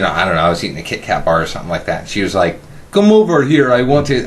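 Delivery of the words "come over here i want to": a weak imitation of a Russian accent, pitched up; mock Russian accent, commanding; bad Russian accent. Coworker at the deli, imitating a Russian lady in a bad Russian accent. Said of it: "that's the worst Russian accent".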